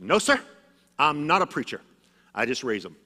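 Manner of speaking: a spunky Oklahoma grandmother putting her foot down; feisty Oklahoma grandma. Retold or imitated. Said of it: "fiery person with an Oklahoma accent".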